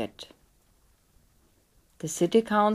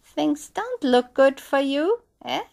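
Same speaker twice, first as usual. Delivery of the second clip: lightly voiced sly little man, higher; a hint of a devilish little stranger; teasing little man, a touch higher; 6 semitones higher, 4 dB louder than usual. A narrator's reading. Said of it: "the little man said".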